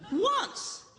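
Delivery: high startled once; startled answer. Retold or imitated. Woman startled by pastor's question answers high. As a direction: blurt it, caught off guard.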